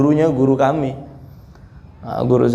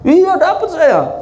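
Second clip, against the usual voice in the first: much higher, slow, drawn-out boast; smug townsman; 13 semitones higher, slower than usual. Retold or imitated. Meccan bragging to the Badui, hammed-up smugness. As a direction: lord it over him slowly, pitched high.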